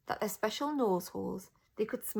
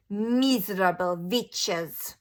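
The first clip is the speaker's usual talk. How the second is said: slow, louder hissed witch insult; yelled; 8 dB louder, much slower than usual. A narrator's reading. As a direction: spit the insult out slowly. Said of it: "she yelled".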